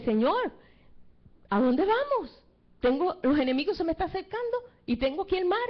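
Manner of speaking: high, pleading cry to the Lord; desperate plea. Acted. Moses trapped at the Red Sea cries to God, high and desperate. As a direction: beg for help, trapped between sea and enemy.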